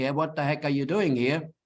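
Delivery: ordinary delivery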